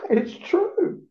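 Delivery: high mock-casual reply; a lightly acted shrug of a reply; retold reply. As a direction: toss it off in a lifted voice. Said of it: "I go".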